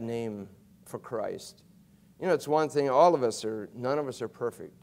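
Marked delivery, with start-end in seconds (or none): none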